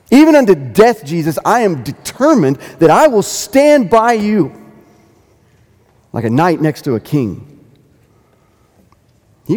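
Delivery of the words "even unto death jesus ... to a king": bold vow. Acted. Peter's bold vow to Jesus.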